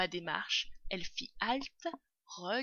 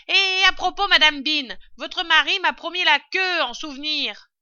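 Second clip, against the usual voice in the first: loud, high call upstairs; shouting; 9 semitones higher, 15 dB louder than usual. A narrator's reading. Servant asking madame Bean for the promised tail, shouting. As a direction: holler up to your mistress. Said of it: "criait-elle".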